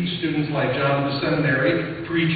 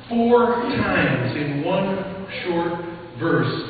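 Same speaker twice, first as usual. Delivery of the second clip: ordinary talk, nothing marked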